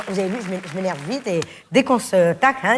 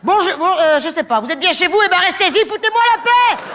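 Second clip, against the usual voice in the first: high, rising mock-grumpy brush-off; rude brush-off; 8 semitones higher than usual. Grumpy answering-machine greeting to unwanted callers, high and rude. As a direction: shoo the callers away, laughing.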